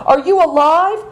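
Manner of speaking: high, frightened cry for her father; wailing. A narrator's reading. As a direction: call out in sudden fear, voice high. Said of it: "wailed Seven Stars".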